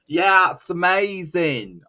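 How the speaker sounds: high, loud, drawn-out bad impression; Ozzy. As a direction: badly imitate an excited wrestler, slow and high. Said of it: "I can't do an Aussie voice".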